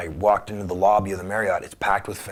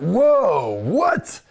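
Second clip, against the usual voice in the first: high, louder burst of surprise; startled; 10 semitones higher, 6 dB louder than usual. Retold or imitated. He answers the pushy phone caller, high startled yelp. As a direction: jolt up like you can't believe it.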